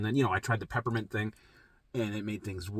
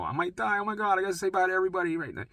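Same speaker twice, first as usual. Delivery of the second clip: 1 mock panic; 8 semitones higher, faster than usual. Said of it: "it was".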